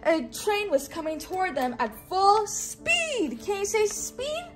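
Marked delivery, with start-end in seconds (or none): none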